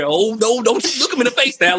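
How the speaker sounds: high, whiny mock fright, pitched well up; hamming up being scared for his friends. Teasing a friend who stares down cops, mock-scared. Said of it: "I played good old scary".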